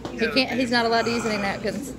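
ordinary talk

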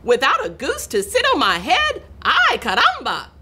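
high, exclaiming hen voice; chicken's cry. A narrator's reading. Chicken tired of the goose, high indignant cry. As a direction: build from indignant question to exclamation. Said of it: "cried the chicken".

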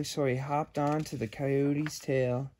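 plain reading voice

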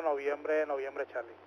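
ordinary talk

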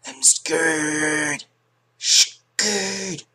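hammed-up scared squeal; mock scared. Player feigns fear in game.

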